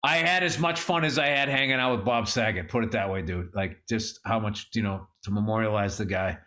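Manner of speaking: low, heavy-hearted voice; subdued sadness. Real, not acted. Man remembering his friend who passed away, subdued sadness. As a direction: drop low and hold the grief back.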